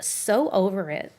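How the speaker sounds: put-on overdone American Valley Girl drawl; hammed-up American Valley Girl accent. Shown as a demonstration. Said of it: "the overdramatic".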